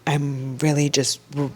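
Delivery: ordinary delivery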